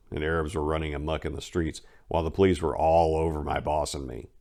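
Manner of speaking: plain reading voice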